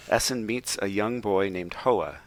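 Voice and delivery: ordinary delivery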